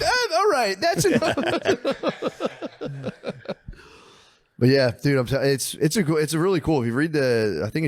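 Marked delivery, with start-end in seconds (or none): 0.0-1.4 flustered teacher. Retold or imitated. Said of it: "He'd be like". 1.4-3.7 high laughing fit. Real, not acted. Laughs after teacher impression.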